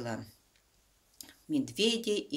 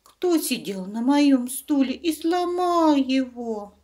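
much higher, louder, slow squeak; squeaky bear cub; 11 semitones higher, 11 dB louder than usual. Acted. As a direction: whine it like a tiny upset cub. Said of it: "пропищал".

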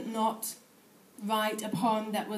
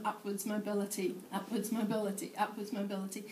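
a repeated phrase tailing away softer and quicker; fading repetition; 6 dB quieter, faster than usual. Acted. Poet hanging out for upwards mobility, fading refrain. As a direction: let each repeat drop away.

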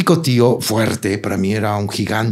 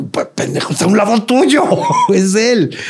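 laughing mock exclamation; 12 semitones higher than usual. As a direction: blurt it out while cracking up. Uncle's retold line to father. (imitación del tío, mucho más aguda y entre risas)

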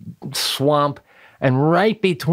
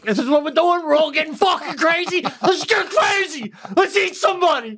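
much higher, manic pretend dog, laughing by the end; crazed dog voice; 13 semitones higher than usual. Dog gets swept up in the household fighting, manic and high. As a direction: whip it up like a dog gone wild.

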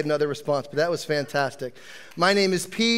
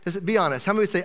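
ordinary delivery

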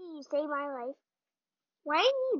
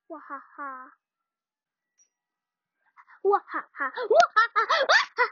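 villain laugh growing wild; evil cackle; 4 semitones higher, 8 dB louder than usual. Acted. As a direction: cackle building higher and louder. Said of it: "I am so evil".

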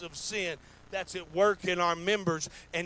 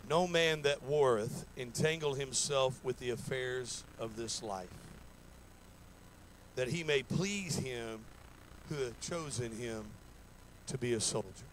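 scripture reading; 5 semitones lower than usual. Acted. Preacher reading Second Timothy to church, solemn. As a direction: read the verse like holy words.

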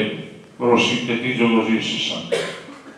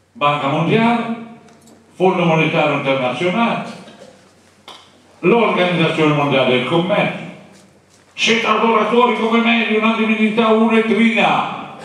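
raised, forceful sermon voice; preaching denunciation; 9 semitones higher than usual. Acted. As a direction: accuse the world institutions from the pulpit. (predica di denuncia, voce alzata)

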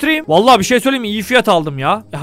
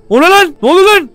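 startled shout; 7 semitones higher, 6 dB louder than usual. Real, not acted. Startled by a homeless man.

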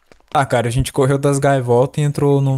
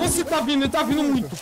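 being chased; 11 semitones higher, 4 dB quieter than usual. Real, not acted. Monster chasing them.

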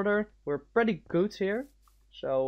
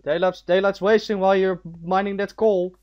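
loud, commanding orders to a teammate; bossy command; 3 semitones higher, 11 dB louder than usual. Real, not acted. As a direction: boss him around like a drill sergeant. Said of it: "that's authority".

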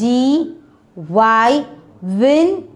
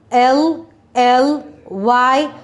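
spelled out slowly; much slower than usual. Shown as a demonstration.

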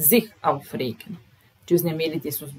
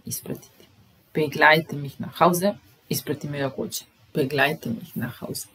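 usual speaking voice

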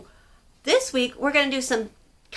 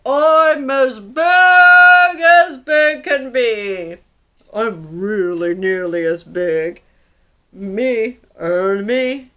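loud, slow, drawn-out boom; booming dinosaur; 10 dB louder, much slower than usual. Acted. Reader voicing huge stomping dinosaur answering Pipkin. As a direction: boom like a giant stomping beast. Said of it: "said the dinosaur".

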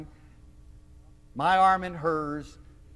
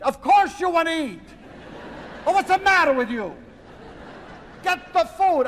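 high, loud, hammed-up Italian aunt scolding; insistent aunt; 12 semitones higher, 4 dB louder than usual. Aunt insisting her visitor must eat, exasperated insistence. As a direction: overrule them dramatically, loud and high.

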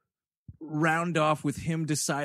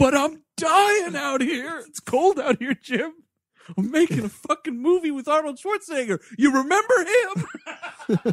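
whiny complaint; 10 semitones higher, 4 dB louder than usual. Retold or imitated. Sam Worthington imagined on the phone.

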